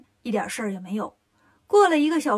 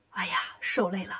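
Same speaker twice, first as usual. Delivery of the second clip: soft, low, whispery voice; hushed sympathy; 5 semitones lower, 6 dB quieter than usual. Acted. Master greets his tired disciple, hushed and low. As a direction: sigh it out gently.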